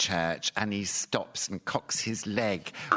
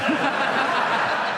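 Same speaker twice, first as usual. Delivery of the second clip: high laugh bursting out; loud laugh; 7 dB louder than usual. Real, not acted. Cock reference joke.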